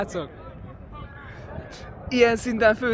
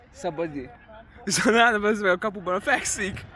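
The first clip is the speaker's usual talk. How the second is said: ordinary talk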